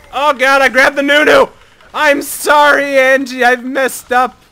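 high, loud, stretched apology; panicked apology. Real, not acted. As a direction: wail your mistake to your teammate. Grabbed wrong target, apologizing.

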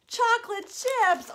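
high, loud announcement; playful storytime excitement; excited reveal. Pretend. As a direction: announce it as a treat for kids. Reveals the next cookie ingredient.

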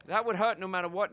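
normal talking voice

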